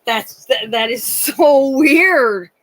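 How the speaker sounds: incredulous. Retold or imitated. Incredulous reply to the old stories.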